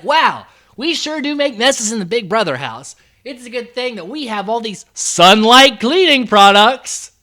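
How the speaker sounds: loud, over-the-top product plug; hammed-up shameless sponsor read; mock commercial. Mocking scripted product plug.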